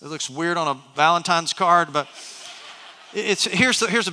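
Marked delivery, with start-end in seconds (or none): none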